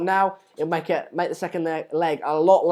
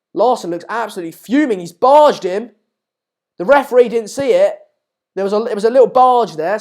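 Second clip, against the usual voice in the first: excited commentary; 3 semitones higher, 9 dB louder than usual. Real, not acted. Commentating a scuffle on the pitch.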